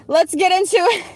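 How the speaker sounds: high, loud launch into reading; high, punchy let's go; hyped restart. Real, not acted. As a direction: kick it off with energy.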